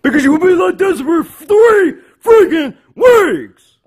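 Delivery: high, loud shout, punching the last words; acted angry outburst; exasperated shout. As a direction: yell it at the end of your patience. Exasperated answer to why.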